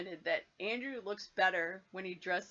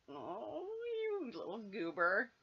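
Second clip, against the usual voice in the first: tender teasing; 4 dB quieter, slower than usual. Retold or imitated. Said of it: "and I'm like".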